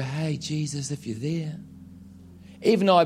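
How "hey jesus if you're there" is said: soft, hesitant call, barely voiced; timid half-hearted call to Jesus; timid little call. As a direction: call out meekly, hoping not to be heard. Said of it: "make up a little noise and go".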